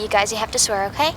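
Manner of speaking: hushed, low secret voice; whispering a secret. Acted. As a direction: lean in and share the secret quietly. Asking friends to keep secret.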